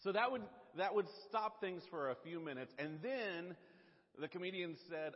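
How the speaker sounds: normal talking voice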